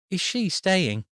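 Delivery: put-on high curious girl; eager little girl; young girl. A narrator's reading. Child voiced by narrator.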